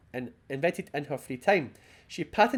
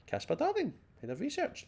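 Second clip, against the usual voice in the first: high pitched; 10 semitones higher, 5 dB quieter than usual. Pretend. Reading a signature with flourish.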